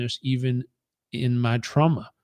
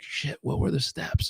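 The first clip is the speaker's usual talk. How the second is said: quiet panic; 8 dB quieter than usual. Retold or imitated. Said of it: "And I was like".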